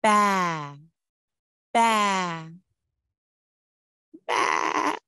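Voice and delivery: lamb bleat. Shown as a demonstration. Teacher showing the lamb sound to children, bleating. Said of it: "The lamb says".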